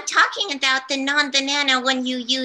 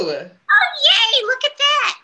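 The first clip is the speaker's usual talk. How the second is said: high, loud, delighted squeal; bright happy cheer, higher and louder; 6 dB louder, slower than usual. Real, not acted. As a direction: cheer it like great news. Host celebrates shared identity.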